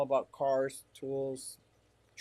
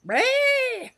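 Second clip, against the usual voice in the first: a much higher, much louder mock goat cry; goat bleat; 12 dB louder than usual. Telling chat about goats, mock goat scream. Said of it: "the goats that go".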